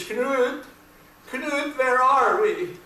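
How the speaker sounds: high, drawn-out mock call; dazed calling out. Ole calling Knut from the wreckage, high mock calling out. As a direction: call for your friend, hammed up and stretched.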